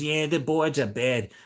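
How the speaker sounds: slow, drawn-out put-on voice; imitating old lady. Old lady admits deck is rotten, mimicked slowly. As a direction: mimic her, stretching the words. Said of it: "She goes".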